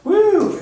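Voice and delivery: high, loud "woo"; cheering whoop. Real, not acted. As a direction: whoop along with the crowd. Cheering the season launch with audience.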